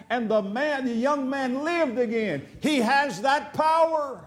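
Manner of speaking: full-force preaching. Real, not acted. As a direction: drive it home to the church.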